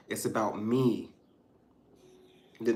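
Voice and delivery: ordinary talk, nothing marked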